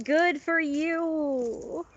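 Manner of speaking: slow, drawn-out, high sarcastic cheer; high, stretched mock praise. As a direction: drag it out with mock approval. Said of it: "And I'm like".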